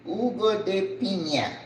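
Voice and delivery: "Jugo de piña" said HOO-go deh PEE-nya; Spanish pronunciation of "Jugo de piña"; Spanish phrase. Shown as a demonstration. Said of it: "pineapple juice".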